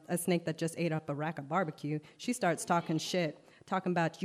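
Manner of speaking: plain reading voice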